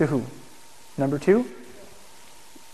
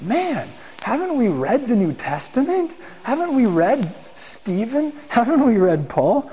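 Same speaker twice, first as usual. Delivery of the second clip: higher, slow, incredulous questions; exasperated disbelief; 5 semitones higher, slower than usual. Retold or imitated. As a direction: plead with them, unbelieving. Preacher's exasperated inner voice.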